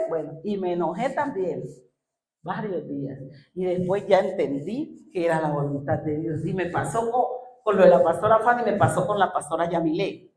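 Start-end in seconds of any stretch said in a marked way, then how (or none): none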